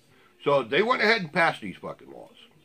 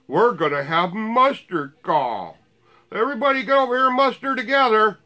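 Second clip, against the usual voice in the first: higher and louder, rushing to a pompous rallying cry; mock muster call; 8 semitones higher, 5 dB louder than usual. Ranting vlogger mocks militia calling a muster, pompous mock yell. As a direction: ham up a self-important militia leader.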